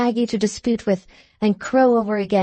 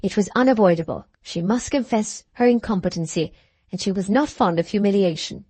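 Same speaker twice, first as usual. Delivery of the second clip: ordinary delivery, nothing marked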